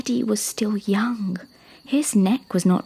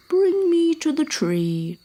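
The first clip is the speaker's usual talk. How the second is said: slow, drawn-out mild boom; raised pitch, stretched like a big creature; booming elephant; 8 semitones higher than usual. Acted. Elephant offering to help, slow and lightly boomed. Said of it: "boomed Alfred".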